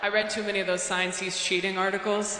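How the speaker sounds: usual speaking voice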